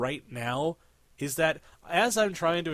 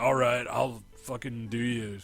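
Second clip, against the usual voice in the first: low, casual dumb-guy line; derpy teen quote; 4 semitones lower than usual. Pretend. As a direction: toss it off like a dopey teen. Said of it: "and he's like".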